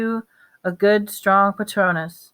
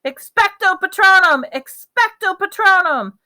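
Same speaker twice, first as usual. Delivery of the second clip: bellowed spell; 12 semitones higher, 7 dB louder than usual. A narrator's reading. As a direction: bellow it with all your strength. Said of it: "Harry bellowed".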